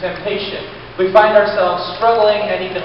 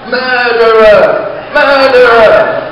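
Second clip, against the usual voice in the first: slow, drawn-out furious scream, much louder; screaming murderer; 2 semitones higher, 8 dB louder, much slower than usual. Retold or imitated. Plates accuse the preacher, screaming slow and loud. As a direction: accuse him at full volume, stretching each syllable. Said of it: "they screamed at me".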